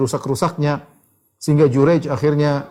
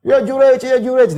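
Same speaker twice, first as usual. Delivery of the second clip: very high, loud call of a name; mother calling; 10 semitones higher, 4 dB louder than usual. Retold or imitated. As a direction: shout his name up the wall, searching. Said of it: "dia panggil".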